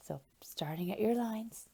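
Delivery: hushed instructions; whisper. Shown as a demonstration. Teacher whispers instructions to children, quiet. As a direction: whisper it to the class. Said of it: "teacher whispering instructions".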